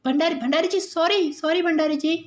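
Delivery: high, pleading sorry; flustered apology. Acted. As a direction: apologise anxiously. Woman apologises after the prank. (घबराकर माफ़ी माँगते हुए)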